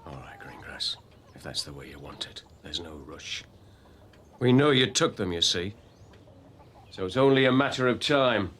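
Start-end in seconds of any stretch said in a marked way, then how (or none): 0.1-3.4 low, menacing near-whisper; quiet threat. Acted. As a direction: say it softly as a warning. Sergeant quietly threatening suspect.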